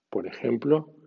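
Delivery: usual speaking voice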